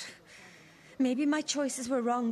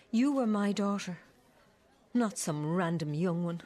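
normal talking voice

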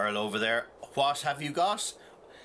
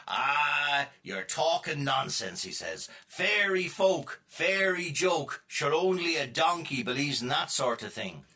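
slow scornful scoff; 5 semitones higher than usual. Acted. Ashy Jack scoffing slowly.

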